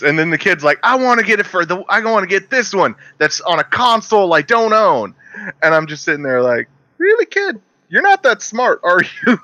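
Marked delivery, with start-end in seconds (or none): 0.8-5.1 put-on higher whiny kid voice. Mocking the kid to the clerk, in a higher whiny voice. 7.0-9.4 much higher mocking sneer; sarcastic. Sneering at the clueless kid in a high mocking voice.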